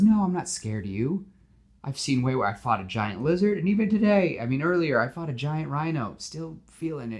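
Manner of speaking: cheerful, chatty superhero; Spider-Man voice. Acted.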